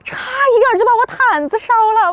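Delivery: shaken and drawn out; startled. Real, not acted. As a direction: say it still startled. Nearly burned the blanket.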